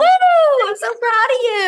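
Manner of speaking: loud, very high whoop of pride; excited cheer. Real, not acted. Host to guest who hit a million, excited cheer. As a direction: cheer them on at full voice.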